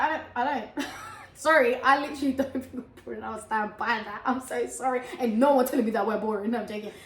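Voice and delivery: ordinary talk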